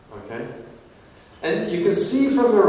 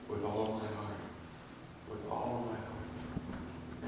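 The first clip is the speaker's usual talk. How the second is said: soft, slow, hushed vow; quiet and earnest; heartfelt; 4 semitones lower, 18 dB quieter, slower than usual. Retold or imitated. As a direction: breathe it out with full sincerity. Convert answering with deep feeling.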